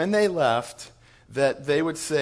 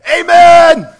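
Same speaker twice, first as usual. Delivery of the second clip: huge shouted amen, much higher and louder; 24 dB louder than usual. Retold or imitated. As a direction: belt it out like a joyful imam in church. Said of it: "Muhammad goes".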